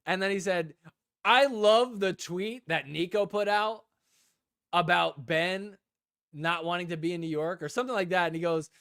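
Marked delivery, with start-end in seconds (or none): 1.2-7.6 raised, slow retelling of a loud spectator; Jake's loud opinion. Retold or imitated. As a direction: announce it for the whole section to hear. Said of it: "He said it so loud".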